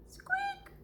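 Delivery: tiny high mouse squeak; a quick squeaky mouse noise. Acted. Squeaking like a tiny mouse hidden in the house.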